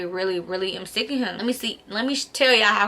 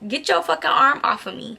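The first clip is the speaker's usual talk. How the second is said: higher-pitched mock-indignant snap; retold indignant retort; 6 semitones higher than usual. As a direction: act out telling him off, pitched up. Acted retort to ex grabbing her.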